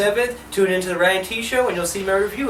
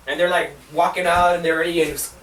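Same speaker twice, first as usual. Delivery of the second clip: ordinary talk, nothing marked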